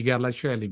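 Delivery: Garlaschelli with a soft "shelli" ending; English pronunciation. Shown as a demonstration. Guest showing the distorted English version, English pronunciation. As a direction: say the ch in Garlaschelli softly, not as k. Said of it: "in the English pronunciation would be".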